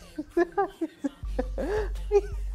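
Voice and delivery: nervous high laugh; awkward laugh. Real, not acted. Awkward moment at the airport.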